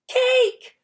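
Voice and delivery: put-on very high, loud baby shriek; baby Sonny demanding cake, very high shriek. A narrator's reading. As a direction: shriek the word. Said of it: "Sonny shrieked".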